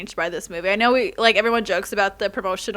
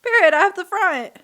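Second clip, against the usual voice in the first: hyped squeal; 10 semitones higher than usual. Real, not acted. Hyped about the sequel.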